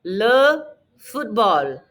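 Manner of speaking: LE FOOTBALL drawn out slowly; teacher modelling LE FOOTBALL for repeating; LE, slight gap, FOOTBALL; slow model pronunciation. Shown as a demonstration. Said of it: "Répétez".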